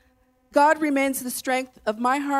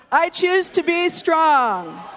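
louder and higher, slow and strong; stronger declaration; 6 semitones higher, 5 dB louder, slower than usual. Shown as a demonstration. Pastor urging the church to say it stronger, loud proclamation. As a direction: proclaim it with full force. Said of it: "That wasn't strong. Say it stronger".

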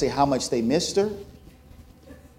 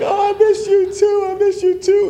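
very high, louder, hammed-up tearful reply; mock tearful aunt; 5 dB louder than usual. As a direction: play the teary aunt, high and gushing. Aunt answering congregation.

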